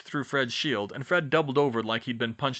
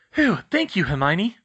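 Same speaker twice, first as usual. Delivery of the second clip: relieved; 6 semitones higher, 6 dB louder than usual. Acted. Relieved after rescue.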